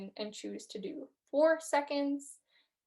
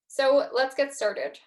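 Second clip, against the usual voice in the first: calm, slow meditation cue; slow guided start; 2 semitones higher, 4 dB louder, slower than usual. Acted. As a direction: ease them into the exercise slowly. Slow call into guided breathing.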